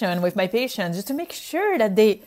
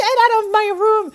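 high, fast alarmed order to leave; startled get out; 12 semitones higher, 3 dB louder, much faster than usual. Retold or imitated. Retold patient ordering the doctor out, quick alarmed order. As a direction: shoo the intruder out, startled and fast. Startled stranger at hospital door.

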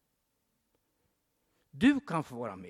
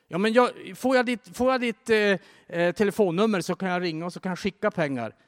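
high, loud, fast excited pleading; overwhelmed stranded man; 6 semitones higher, 6 dB louder than usual. Retold or imitated. Stranded man begs Bruce Wilkinson for his number, excited. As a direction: blurt it out, overwhelmed with gratitude.

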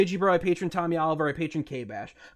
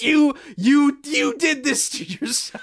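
shout; 8 semitones higher, slower than usual. Real, not acted. Heated rant at e-girls.

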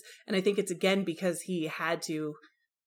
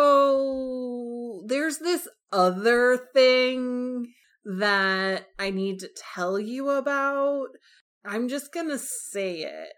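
hesitant, higher put-on confession voice; sheepish Darcy; 4 semitones higher, much slower than usual. Darcy confessing to Bingley, hesitant and sheepish. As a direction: stretch it out like a guilty friend owning up.

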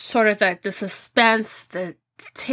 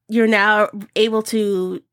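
ordinary delivery

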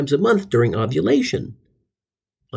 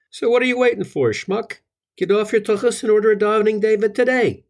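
pushy pitch; 7 semitones higher than usual. Acted.

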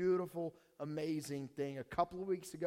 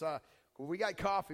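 normal talking voice

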